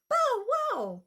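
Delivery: squeaky high bow wow; high puppy bark. Acted. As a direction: yap like a tiny puppy. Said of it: "can you sound like Puddles".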